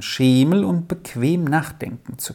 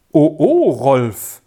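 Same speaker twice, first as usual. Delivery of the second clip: loud "oh, oh" swooping down; mock-horrified exclamation; 5 dB louder than usual. A narrator's reading. Jul hears Rolf's wrong guess, lightly horrified. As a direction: exclaim in mild horror at a blunder. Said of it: "rief Jul entsetzt aus".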